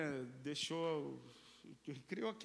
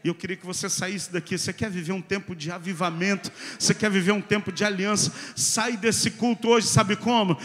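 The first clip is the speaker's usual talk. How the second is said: very loud, driving exhortation; full-force preaching; 21 dB louder than usual. Real, not acted. Preacher urging the congregation to leave indignant, full-force shouted preaching.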